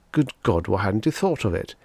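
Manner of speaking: ordinary delivery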